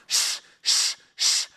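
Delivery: voiceless shushing air noise; balloon pump hiss. Retold or imitated. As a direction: imitate pumping up a balloon. Blowing up balloons.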